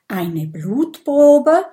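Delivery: slow, whispered astonished question; startled. A narrator's reading. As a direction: gasp it out in alarm. Dragon startled by request.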